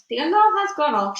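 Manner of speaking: high, loud gleeful shriek; shrieking witch. A narrator's reading. Witch shrieks that the spell is working. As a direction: shriek it in triumph. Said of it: "shrieked the Grand High Witch".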